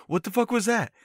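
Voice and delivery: sudden high startled outburst; shocked, confused cry. Retold or imitated. Listeners startled by smoke puff.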